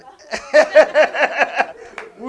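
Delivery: big loud laugh bursting out; loud burst of laughter. Real, not acted. Preacher cracking up at his own clumsy past, loud laugh.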